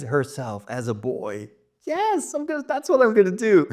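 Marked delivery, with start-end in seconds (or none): 1.9-3.7 light up at the idea; real excitement. Real, not acted. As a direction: writer thrilled by her own plot idea. Speaker decides the plot twist.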